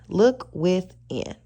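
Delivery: slow it right down to drive it home; slow emphatic advice; slow, deliberate repeat. Shown as a demonstration. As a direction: Look within drawn out slowly, each word weighted.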